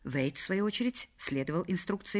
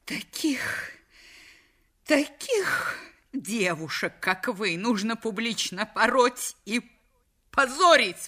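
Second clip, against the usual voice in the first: high, slow, outraged denunciation; lightly voiced furious wife; indignant exclamation; 5 semitones higher, slower than usual. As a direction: hurl the insult at the girl. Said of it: "воскликнула миссис Вейт".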